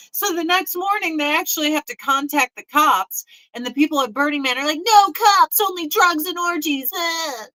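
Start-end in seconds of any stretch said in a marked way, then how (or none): 4.8-6.9 high, loud mocking refusal; hammed-up hippie outcry; mock festivalgoer. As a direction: imitate a druggie protesting, pitched up. Mimicking festivalgoers refusing police.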